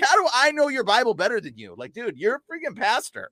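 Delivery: exasperated high scolding; retold chewing out. Scolding a pastor about his own Bible, exasperated. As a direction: needle the pastor, high and incredulous. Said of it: "chewed out a few pastors".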